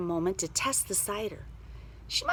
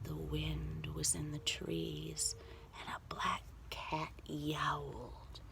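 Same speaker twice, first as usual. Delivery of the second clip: slow, hushed eerie narration; hushed storyteller ending; spooky whisper; 12 semitones higher, slower than usual. A narrator's reading. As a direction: whisper the night closing in.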